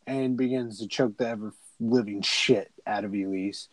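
ordinary talk, nothing marked

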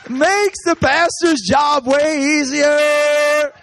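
high, loud and very slow through laughter; laughing. Real, not acted. Speaker joking to audience, laughing high and slow. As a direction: stretch the punchline out, laughing.

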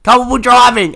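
high, loud burst, imitating him; exclaimed retold answer. Inmate answering what he's in for, loud acted exclamation. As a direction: blurt it out like a big reveal.

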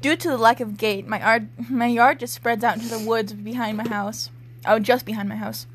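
usual speaking voice

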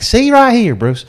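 high-pitched, hammed-up Southern American drawl; southern American accent. Golfer explains his conundrum to Bruce, in a hammed-up Southern American accent. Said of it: "is [name]'s southern American accent good or bad".

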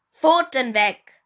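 sharp bark. Acted. Big brother barks at Josh to keep his paws off, loud. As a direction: snap it out like a warning. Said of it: "blaffte Finn ihn an".

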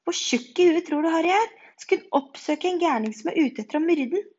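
loud, high, alarmed outburst; shaken. A narrator's reading. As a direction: protest in disbelief and fright. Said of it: "sa Ronny skjelvent". (opprørt, lys og høy stemme)

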